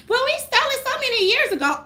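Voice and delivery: snide mock of sorority girls; mocking thieves. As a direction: sneer it in their voice. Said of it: "They be like".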